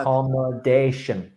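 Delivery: Accommodation with stress on the fourth syllable; correct pronunciation. Shown as a demonstration. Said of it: "the word accommodation".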